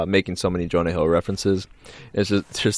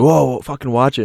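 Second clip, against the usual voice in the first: acted alarm; 7 semitones higher, 6 dB louder than usual.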